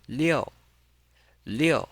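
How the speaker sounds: liu high, loud, falling; fourth tone. Shown as a demonstration. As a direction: drop "liu" firmly from a high start. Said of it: "pronounce it with a fourth".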